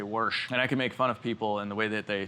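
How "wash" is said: "wash" said as "warsh" with an r before the sh; warsh pronunciation. Retold or imitated. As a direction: say "wash" like "warsh", Midwest r slipped in. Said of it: "They say".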